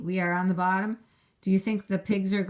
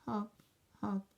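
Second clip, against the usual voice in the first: sad kangaroo; 2 semitones higher, 8 dB quieter, slower than usual. Acted. Rejected kangaroo hopping off, soft and sad. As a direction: sigh it out, the kangaroo lost. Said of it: "the kangaroo sadly says".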